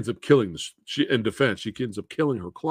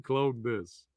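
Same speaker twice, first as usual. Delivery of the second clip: Arnold voice; 6 dB quieter than usual. Retold or imitated. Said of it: "but he said".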